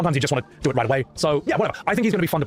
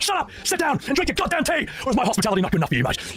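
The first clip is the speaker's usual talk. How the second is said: much higher mock rage at Shira; much higher hammed-up rant; raging Sid; 10 semitones higher than usual. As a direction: ham up a furious hot-headed pilot. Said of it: "It's like".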